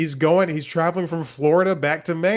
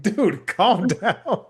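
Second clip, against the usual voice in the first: laughing; 7 semitones higher, much slower than usual. Retold or imitated. Brushing off the fan.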